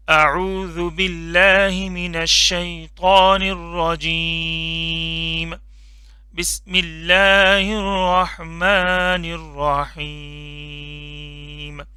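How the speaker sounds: slow, measured Quranic recitation. Real, not acted. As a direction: recite it slowly and reverently. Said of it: "औज़ुबिल्लाह, बिस्मिल्लाह पढ़ लेते हैं". (धीमी, अदब से तिलावत)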